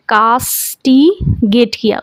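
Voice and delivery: Castigate किया said slowly, each syllable drawn out; slow repeat. Shown as a demonstration. Teacher repeating the word slowly for students to catch it. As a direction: stretch castigate किया out for the class.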